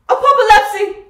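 proclaimed at full shout. Acted. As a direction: cry out the doom. Seer proclaims doom to audience.

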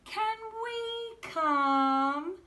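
put-on very high, slow pleading kid; high slow little boy asking; small child's voice. Child begging to come along.

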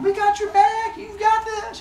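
very high little cheerleader voices; cheering friends. Acted. Arachne's friends backing her up, high-pitched cheering. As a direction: squeal encouragement from behind. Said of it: "her friends are behind her going".

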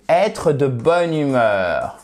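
ordinary talk, nothing marked

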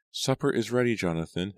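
mother calling. A narrator's reading.

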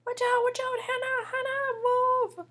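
high, alarmed calling out; urgent warning. Acted. Sliding toward Hannah, calling out an urgent warning. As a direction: warn someone to get out of the way. Warns someone in the way.

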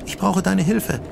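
put-on high girl's voice calling out. A narrator's reading. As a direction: call her as a frightened girl. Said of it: "eine Mädchenstimme". (hohe Mädchenstimme, rufend)

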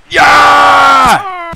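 high held yell of triumph; big held victory yell; loud cheer. Pretend. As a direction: shout it out long and high. Game character cheer echoed.

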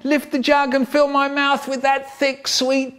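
can't wait to drink it; eager relish. A narrator's reading.

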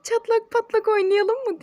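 high, loud playful doll voice; baby doll voice. Retold or imitated. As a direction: echo the toy in a bright squeaky voice.

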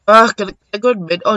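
ordinary delivery

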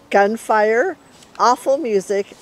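usual speaking voice

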